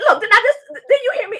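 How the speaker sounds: high, loud mock scolding with a laugh; going off. RA confronting loud neighbor at his door, mock scolding. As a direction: tell him off, half laughing. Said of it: "I went off".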